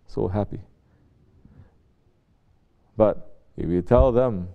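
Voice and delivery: ordinary delivery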